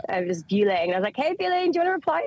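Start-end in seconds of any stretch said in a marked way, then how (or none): 1.2-2.2 mock sweet call-out. Mocking airline for ignoring her.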